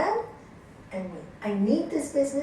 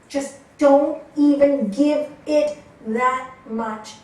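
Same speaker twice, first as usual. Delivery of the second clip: slow and loud, each word pressed; emphatic advice; 3 semitones higher, 10 dB louder, much slower than usual. Shown as a demonstration. Yoga teacher pressing students not to feed a quarrel, slow emphasis. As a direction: lean hard on "don't even give it".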